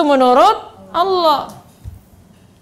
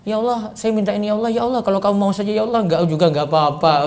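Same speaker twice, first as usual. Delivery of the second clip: quick, softer, shrugging plea; half-hearted prayer; 3 semitones lower, 4 dB quieter, faster than usual. Shown as a demonstration. Ustaz mocking a weak half-hearted prayer, quick and soft. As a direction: mumble it as if you don't care. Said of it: "doa dipertegas ... jangan gitu".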